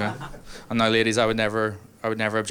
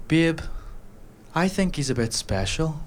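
put-on higher, pitying girlfriend voice; 6 semitones higher than usual. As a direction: say it sweetly with mild pity. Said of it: "she says to the fridge".

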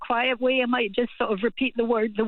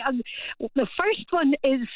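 usual speaking voice

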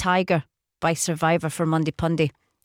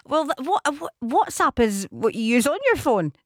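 higher, halting mock Virginian accent; put-on Virginian accent; 4 semitones higher than usual. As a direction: keep up the playful Virginian accent.

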